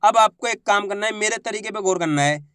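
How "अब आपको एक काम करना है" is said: ordinary delivery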